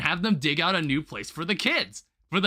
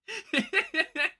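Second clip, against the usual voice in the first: high laugh; 13 semitones higher than usual. Real, not acted. Bursts out laughing at own rant.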